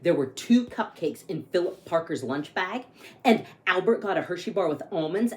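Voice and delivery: lively read-aloud storytelling; story narration. A narrator's reading. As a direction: read it like a picture book to kids. Reads picture book aloud.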